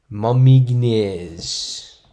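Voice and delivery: "Momignes" attempted loud and drawn out as one long foreign word; botched pronunciation attempt. Real, not acted. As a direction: take an overblown crack at "Momignes". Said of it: "shouldn't have taken a crack at that".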